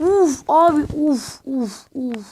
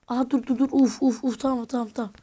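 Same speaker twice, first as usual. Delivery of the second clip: usual speaking voice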